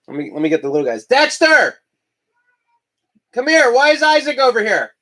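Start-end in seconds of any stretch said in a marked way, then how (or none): 1.0-4.9 calling out. Real, not acted. Calling dog from another room.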